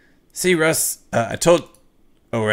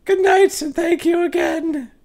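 much higher polite woman's voice; woman client; 4 dB louder, slower than usual. Acted. Anna thanks the ghost hunter, much higher woman's voice. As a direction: play the relieved client, high and gracious.

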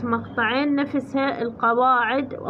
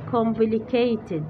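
slow text reading; much slower than usual. Shown as a demonstration. Slowly reads the English text.

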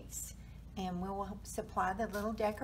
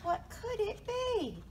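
high, wondering mouse voice; high-pitched storybook puzzlement; startled mouse; 12 semitones higher than usual. A narrator's reading. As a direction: ask it high, like a little mouse. Mouse wonders after squeaking.

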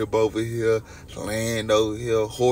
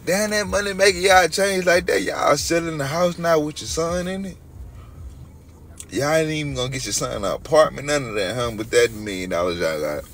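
louder, wounded complaint aimed at mama; real aggrieved pleading to a mother watching the stream; hurt, raised voice; 4 semitones higher, 5 dB louder than usual. Real, not acted. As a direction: call her out loudly, hoping she hears. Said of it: "I'm talking like this because I hope my mama really watching".

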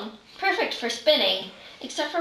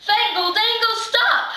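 panicked shout; 8 semitones higher than usual. Acted. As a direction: yell stop in a panic. Spell going wrong, panic.

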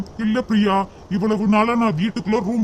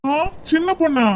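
amused laugh; 6 semitones higher, faster than usual. Acted. Amused at name.

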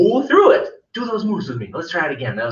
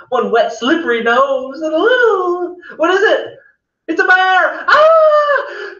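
loud, much higher playful scream; mock panic; 11 semitones higher, 6 dB louder than usual. Teacher pretending to find a bear, high mock panic. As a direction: shriek like you found a bear.

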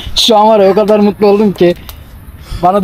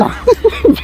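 high laugh; 12 semitones higher than usual. Real, not acted. Laughs during freeze tag.

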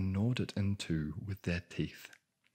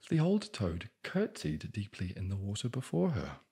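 plain reading voice

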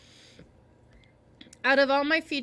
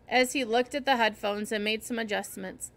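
usual speaking voice

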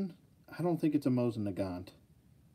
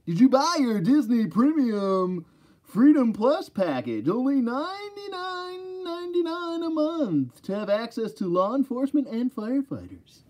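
strip club DJ voice; 12 semitones higher, 5 dB louder than usual. Shown as a demonstration. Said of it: "like, a strip club D J voice".

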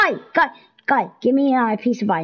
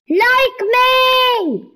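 high, loud plea to the horse; desperate cry of like me; 1 pleading shout; 13 semitones higher, 5 dB louder than usual. Real, not acted. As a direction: beg loudly up high. Begging the horse.

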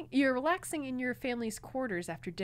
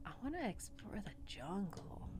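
unvoiced whisper; 8 dB quieter than usual. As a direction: breathe it in a whisper. Whispered echo of line.

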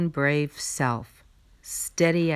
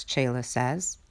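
ordinary delivery

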